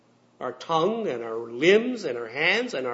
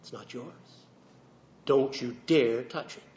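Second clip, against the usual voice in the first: little inner voice; 3 semitones lower, 4 dB quieter than usual. Acted. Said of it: "the little voice said".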